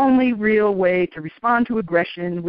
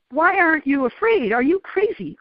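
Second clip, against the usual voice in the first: higher-pitched, mildly confronting questions; mock aggressive challenge; 7 semitones higher than usual. Gunmen questioning a lone pilgrim, mock aggressive. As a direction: imitate their challenge, not really angry. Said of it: "they were trying to be aggressive".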